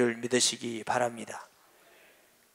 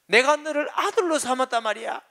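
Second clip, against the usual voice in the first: higher, pressing 아들로 hard in a mock rebuke; angry insistence; 9 semitones higher than usual. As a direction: drive it home on 아들로, indignant. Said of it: "화를 냈어요".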